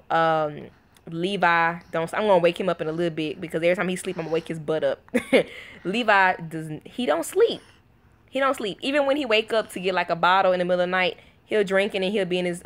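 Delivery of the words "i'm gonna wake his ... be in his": ordinary talk, nothing marked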